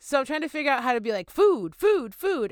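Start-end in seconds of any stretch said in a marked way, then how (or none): 1.3-2.5 1 crow food call. Retold or imitated. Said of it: "what they do when they find food".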